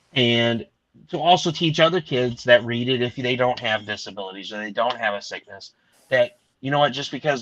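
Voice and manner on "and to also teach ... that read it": normal talking voice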